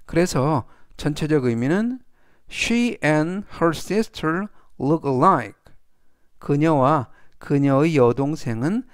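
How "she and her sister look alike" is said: very slow, deliberate example sentence; slow model reading. Shown as a demonstration. Teacher reading the example aloud to students, slow and clear. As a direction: spell each word out for learners.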